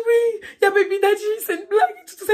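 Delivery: high, loud half-laughing half-crying; teen girl in a giggly panic telling her friend; panicked squeal. Retold or imitated. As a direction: squeal it out, overwhelmed and giddy.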